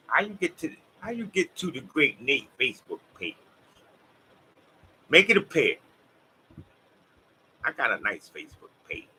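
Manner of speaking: normal talking voice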